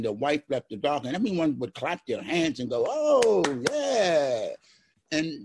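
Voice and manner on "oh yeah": high, loud imitated cheer; imitating the room's cheer; mock crowd cheer. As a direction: ham up the meeting crowd cheering.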